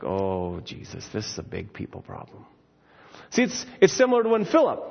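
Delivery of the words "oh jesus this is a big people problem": breathy whisper, much lower. Retold or imitated. Martha answering Jesus, sighing it out in a whisper. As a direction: let it fall to a whisper. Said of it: "And she's like".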